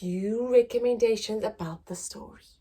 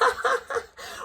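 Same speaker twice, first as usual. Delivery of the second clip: high cackling laugh; big laugh. Acted. Mother laughing at being caught, high laugh. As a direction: burst out laughing. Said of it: "began to laugh".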